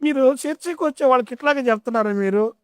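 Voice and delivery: put-on old mother complaining. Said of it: "ఒక తల్లి వచ్చింది".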